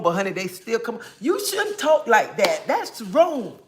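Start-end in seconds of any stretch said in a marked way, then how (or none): 1.2-3.6 mock scold. Mocking the negative commenters.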